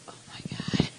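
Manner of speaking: stunned whisper under the breath; whispered aside. Retold or imitated. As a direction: whisper it so he can't hear. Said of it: "stunned aside".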